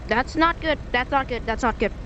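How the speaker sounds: ordinary talk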